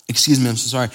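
normal talking voice

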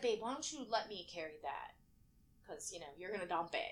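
low, soft, patient aside; retold boyfriend's offer. Boyfriend offering to carry the food, low and soft. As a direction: quietly imitate a partner gently stepping in. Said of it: "he's like".

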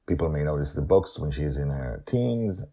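usual speaking voice

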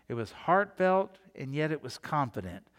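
normal talking voice

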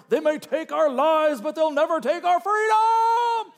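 hammed-up Scottish battle cry, deliberately poor accent; bad Scottish accent. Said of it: "That's the worst accent you've probably ever heard".

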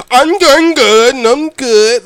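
high, loud, very slow mock voice of a slow-witted woman; imitating Behemoth. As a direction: stretch each word out, high and loud, mocking her speech. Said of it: "That's how she talked".

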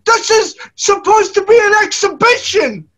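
high put-on movie voice; Rocky Four impression. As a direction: quote it like the Rocky Four commentator. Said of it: "listen to my impression".

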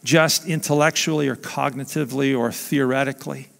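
soft, low closing prayer; hushed pastor's prayer at the end. Acted. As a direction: pray it quietly to the Father.

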